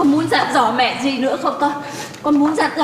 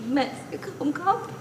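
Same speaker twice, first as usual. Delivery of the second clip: choked quiet promise; 4 semitones higher, 9 dB quieter than usual. Acted. Mother holding back tears.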